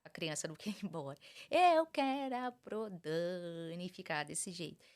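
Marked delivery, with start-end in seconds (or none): 1.5-3.9 put-on slow, drawn-out little kid plea; lightly voiced whiny child dragging the words; clingy child voice. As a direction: imitate a kid who won't go home.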